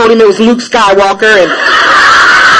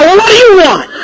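higher, louder squeaky clerk voice; the little drive-thru guy snapping at her, higher and louder; 10 semitones higher, 4 dB louder than usual. Retold or imitated. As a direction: mimic the impatient little guy in the speaker box. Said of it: "don't ask me".

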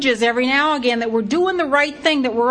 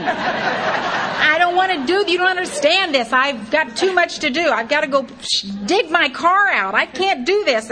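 mock complaint while laughing; mock whining; 6 semitones higher, 3 dB louder than usual. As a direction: whine like a reluctant child.

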